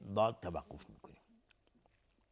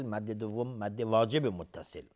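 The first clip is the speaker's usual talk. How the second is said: ordinary delivery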